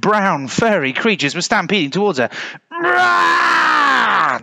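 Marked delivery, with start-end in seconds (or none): 2.7-4.4 long, high roaring yell; drawn-out high war cry; soldiers' battle cry. A narrator's reading. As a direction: roar like troops charging. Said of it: "they cried like soldiers running into battle".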